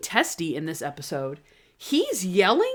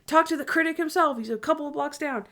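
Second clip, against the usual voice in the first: mild mock yell; 6 semitones higher, faster than usual. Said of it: "He yells in every single scene".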